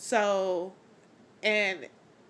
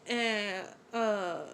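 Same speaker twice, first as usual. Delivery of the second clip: ordinary delivery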